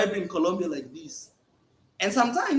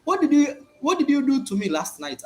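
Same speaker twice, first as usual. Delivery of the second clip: accusing woman's shout, higher; angry woman; 7 semitones higher, 3 dB louder than usual. Retold or imitated. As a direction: demand it like an upset date. Woman confronting man next morning.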